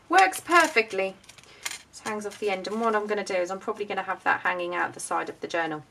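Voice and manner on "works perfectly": delighted. Real, not acted. Delighted it works.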